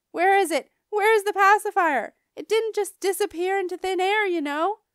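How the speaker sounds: high, loud, frantic mother's yell; loud holler. A narrator's reading. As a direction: holler it out like a mom at her wit's end. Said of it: "she hollered very loud".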